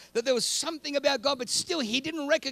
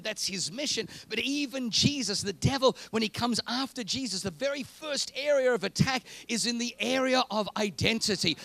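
normal talking voice

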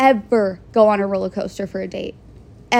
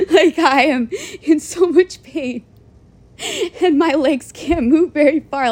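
higher voice broken up by laughing; helpless laughter; 5 semitones higher than usual. Real, not acted. Vlogger reliving the too-tight pants disaster, laughing helplessly. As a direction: squeeze the words out through real laughter.

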